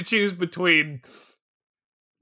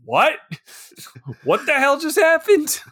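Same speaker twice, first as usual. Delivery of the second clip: rising, higher bewildered what; confused disbelief; 8 semitones higher than usual. Retold or imitated. Retelling Hunter's confusion after the prank, higher bewildered voice. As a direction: imitate Hunter waking up baffled, voice climbing. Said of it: "right there with the viewer in confusion".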